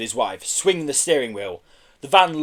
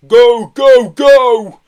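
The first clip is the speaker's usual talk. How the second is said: very loud, high urgent yell; 9 semitones higher, 14 dB louder, much slower than usual. A narrator's reading. Mambo urging a quick getaway, yelling. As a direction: urge the driver on at full volume. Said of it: "yelled Mambo".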